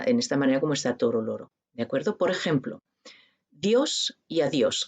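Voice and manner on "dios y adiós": "Dios y adiós" said slowly, each d shown clearly; slow demo of the d in "Dios y adiós"; slow pronunciation demo. Shown as a demonstration. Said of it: "que se pronuncia así".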